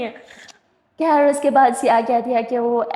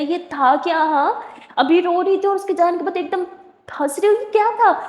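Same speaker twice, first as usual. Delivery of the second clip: climbing higher in disbelief; can't believe what she just saw; surprised; 5 semitones higher than usual. Acted. Shocked at Sara's fake tears.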